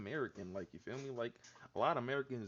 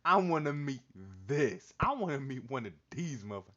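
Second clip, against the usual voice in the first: louder, fired up; loud hyped; 3 semitones higher, 8 dB louder than usual. Real, not acted. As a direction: shout it with excitement.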